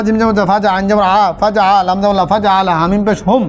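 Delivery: usual speaking voice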